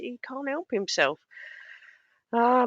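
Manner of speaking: usual speaking voice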